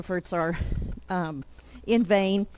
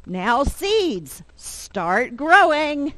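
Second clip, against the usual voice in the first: higher, louder storybook command; insistent Toad; 6 semitones higher, 4 dB louder, much slower than usual. Acted. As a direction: press the seeds, stretching out the words. Toad repeats command more insistently.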